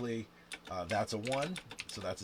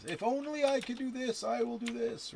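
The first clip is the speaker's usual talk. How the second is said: much higher, imitating a pleading gambler; mock bargaining voice; 13 semitones higher, 5 dB louder than usual. Player mimicking himself bargaining with the dice, mock pleading. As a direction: voice the inner bargainer in a put-on high tone. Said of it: "Am I making promises?".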